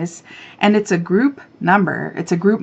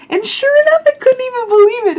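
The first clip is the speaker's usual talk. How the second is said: high, louder thrilled disbelief; giddy excitement; 6 dB louder than usual. Real, not acted. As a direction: gush like you just won. Retelling contest win.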